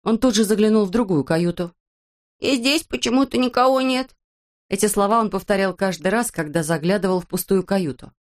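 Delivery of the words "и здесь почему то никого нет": high, wondering character voice; high voice, finding nobody there; puzzled Незнайка. Acted. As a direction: play a puzzled storybook fellow, pitched up. Same character repeats himself.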